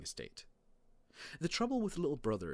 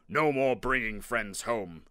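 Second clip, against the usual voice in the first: loud, slow fatherly decree; declaring; 10 dB louder than usual. A narrator's reading. Dad banning friends from the house, loud declaration. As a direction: lay down the law, booming and drawn out. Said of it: "Dad declared".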